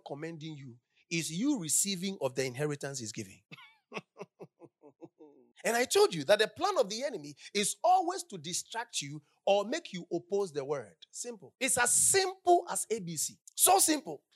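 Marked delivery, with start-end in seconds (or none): none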